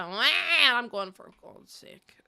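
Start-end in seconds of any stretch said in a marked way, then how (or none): 1.1-2.1 very soft, slow whispered grumble; exasperated. Retold or imitated. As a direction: whisper it to yourself, fed up. Retold exasperation on the toilet.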